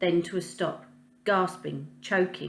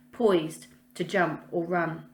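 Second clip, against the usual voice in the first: plain reading voice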